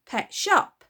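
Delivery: put-on loud, blunt girl answer; a hint of surly child; offhand girl. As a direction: toss it off carelessly.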